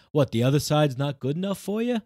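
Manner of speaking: hint of a New York Bronx accent, sarcastic; sarcastic Bronx jab; mock Bronx accent. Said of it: "New York, Bronx accent".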